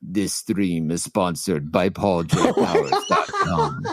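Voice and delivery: put-on low, slow old-narrator voice reading an ad; mock-solemn low sponsor announcement; sponsor-read impression. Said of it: "if at some point he said".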